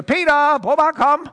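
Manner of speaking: high, loud beckoning shout; calling out. Acted. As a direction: call someone over from far off. Jesus calls Peter over the water.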